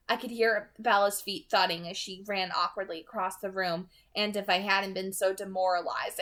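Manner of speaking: ordinary delivery, nothing marked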